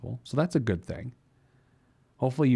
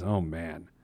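lower, softer dismayed groan of words; dismayed oh man; 6 semitones lower, 5 dB quieter than usual. Shown as a demonstration. Host sounding out the worst savings tier, dismayed. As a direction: sink into a let-down oh man. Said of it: "express that via sound".

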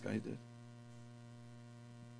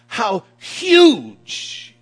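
loud, high, drawn-out HUGE; booming emphasis; 10 semitones higher, 13 dB louder than usual. Real, not acted. Preacher telling men what great means, booming emphasis. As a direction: punch out huge at full voice.

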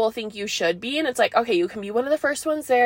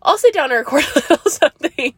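fast, laughing through the words; 4 semitones higher, 4 dB louder, faster than usual. Real, not acted. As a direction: giggle while saying it. Laughs mid-line while speaking.

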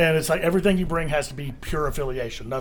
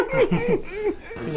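laughing. Real, not acted. Laughing at own win.

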